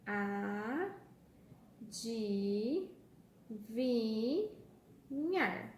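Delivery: "Adivinhar" drawn out very slowly as a... di... vi... nhar; split into syllables. Shown as a demonstration. Teacher separating the word for pupils, syllable by syllable. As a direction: say "Adivinhar" with a long gap after each syllable. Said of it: "vamos separar em sílabas?".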